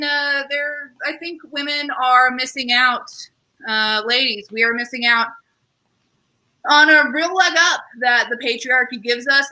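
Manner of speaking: usual speaking voice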